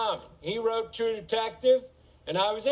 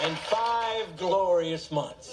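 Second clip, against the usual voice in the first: slow punchline; much slower than usual. Acted. Punchline, drawn out slowly.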